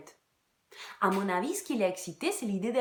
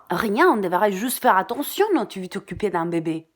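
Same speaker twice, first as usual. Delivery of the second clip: louder urgent warning; anxious plea; 7 dB louder than usual. Acted. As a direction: press him to be careful. Worried plea to lover.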